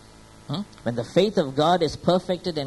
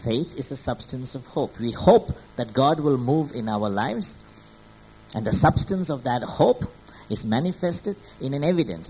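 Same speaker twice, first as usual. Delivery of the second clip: normal talking voice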